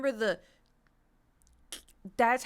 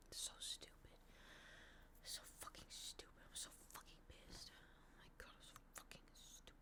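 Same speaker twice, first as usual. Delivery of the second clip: hushed, unvoiced cursing under the breath; whispered venting; 26 dB quieter than usual. Real, not acted. Streamer cursing a lost login, frustrated whisper.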